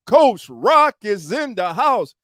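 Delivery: slow, loud, high introduction; big slow hype intro for the co-host; hype announcement. Real, not acted. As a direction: announce him like a ring entrance.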